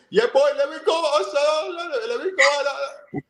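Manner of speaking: teasing a teammate with a high Trinidad lilt; Trinidad accent impression. Mimicking teammate Shaka in a high Trinidad accent. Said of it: "Shaka talk with him Trinidad accent".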